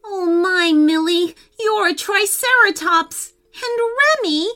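high-pitched storybook amazement; astonished. A narrator's reading. Prince seeing his sisters as dinosaurs, astonished. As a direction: exclaim in mounting disbelief as the prince.

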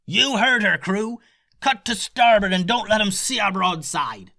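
high, loud barked ship order; captain's yell. A narrator's reading. Captain ordering his crew to turn starboard, bellowing. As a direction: shout the crew into action. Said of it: "yelled the captain".

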